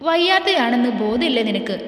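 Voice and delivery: plain reading voice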